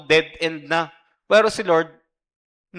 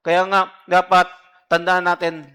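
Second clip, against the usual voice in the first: preaching emphasis; 3 semitones higher, 7 dB louder than usual. Real, not acted. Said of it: "preacher exhorting the church".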